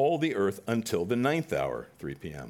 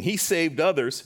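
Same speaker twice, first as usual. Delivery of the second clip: higher, louder mocking jab; first sneer, higher and louder; 9 semitones higher, 8 dB louder than usual. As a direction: toss off a sneer at the cross. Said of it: "even the rulers with them sneered".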